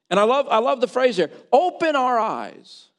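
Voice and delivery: emphatic pulpit delivery; forceful preaching. Real, not acted. Preaches on the phrase.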